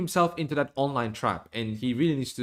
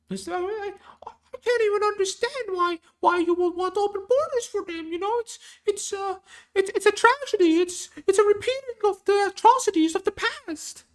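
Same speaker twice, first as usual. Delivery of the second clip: far higher, thin falsetto parody voice; Jordan Peterson impression. Streamer parodying Jordan Peterson on open borders, high falsetto impression. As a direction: lampoon the professor in a squeaky falsetto that slides toward a woman's voice. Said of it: "That's more like a woman. It's not Jordan".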